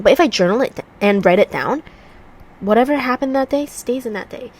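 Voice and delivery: ordinary delivery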